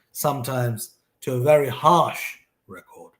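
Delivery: usual speaking voice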